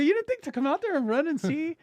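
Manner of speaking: can't-believe-it mock outrage; exasperated. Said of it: "I go".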